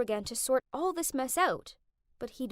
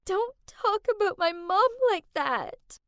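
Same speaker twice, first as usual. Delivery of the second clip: sobbing; 8 semitones higher, 4 dB louder than usual. Acted. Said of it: "Sobbing, Kath replied".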